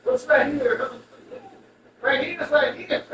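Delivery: loud, laughing through the words; laughing quote. Retold or imitated. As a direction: laughs while retelling what was said. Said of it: "then I said".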